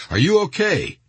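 high-pitched call across a distance; shouted check. A narrator's reading. Delta medic to wounded sergeant across the street, restrained narrated shout. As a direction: call over to someone, voice lifted high. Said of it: "shouted at him from across the street".

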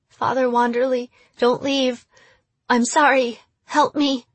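put-on high, young, begging voice; pleading, near tears. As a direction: beg like a scared little girl. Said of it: "in a pleading, near-tears voice".

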